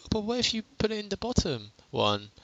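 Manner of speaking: high-pitched mock query; viewer's question. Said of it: "you may ask?".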